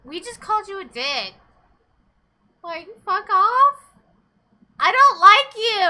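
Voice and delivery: high, loud, slow mock snap; mock telling off. Voicing the girl snapping at the boy, slow mock anger. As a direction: play the character telling him off.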